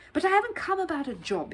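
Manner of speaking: exclaiming. Acted. Bear protesting to the gatekeeper, high and eager. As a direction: blurt it out to be heard at last. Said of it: "he exclaimed".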